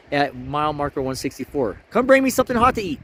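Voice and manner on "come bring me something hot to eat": calling out. Acted. Calling out playfully.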